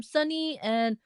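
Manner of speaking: ordinary talk, nothing marked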